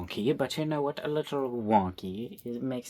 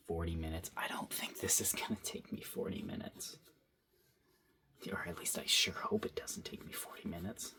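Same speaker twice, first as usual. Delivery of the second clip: whisper; 5 semitones lower, 9 dB quieter than usual. Real, not acted. Muttering about storage.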